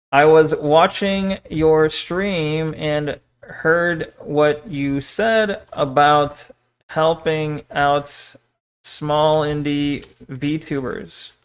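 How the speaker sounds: very slow, careful message dictation; composing aloud. Real, not acted. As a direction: draw out each phrase as you draft it.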